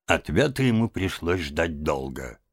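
plain reading voice

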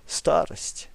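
unvoiced resigned whisper; whispered sigh. Grandmother sighing about her age. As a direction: breathe it out, resigned.

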